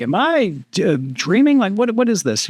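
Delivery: half-asleep wondering if it's a dream; retelling waking up to a helicopter; incredulous. Retold or imitated. Retold disbelief at helicopter.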